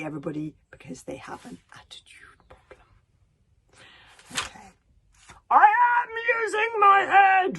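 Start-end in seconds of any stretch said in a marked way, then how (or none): 5.5-7.6 high, slow bellowing shout; furious roar. Acted. Stoic headbutting the wall, roaring at Hiccup. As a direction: bellow it while butting the wall. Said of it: "roared Stoic the Vast".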